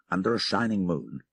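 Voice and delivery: usual speaking voice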